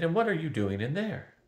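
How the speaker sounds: high-pitched pointed question; demanding. A narrator's reading. Girl questions the stranger behind the gate, demanding. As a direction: press for an answer, rising at the end. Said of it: "Simrine demanded".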